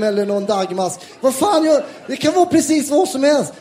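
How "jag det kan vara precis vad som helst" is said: ordinary talk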